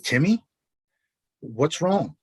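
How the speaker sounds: a high, gentle, worried voice; an old family friend asking kindly what's wrong; concerned older man. Retold or imitated. Late friend's father greets crying Timmy at the reservoir, gently concerned. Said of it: "And he says".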